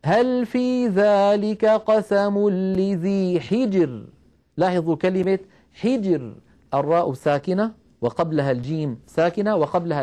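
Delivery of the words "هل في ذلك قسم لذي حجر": slow tajweed recitation, thin r in حجر; تلاوة مرتلة بطيئة; Quran recitation. Shown as a demonstration. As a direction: chant the verse slowly and evenly. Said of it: "نرقق كما قلنا".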